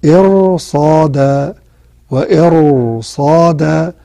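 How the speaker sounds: إرصادا said slowly, R heavy before the صاد; slow recitation of إرصادا; heavy R demo. Shown as a demonstration. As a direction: draw out إرصادا with a thick R. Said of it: "راء ساكنة قبلها مكسور بعدها الصاد".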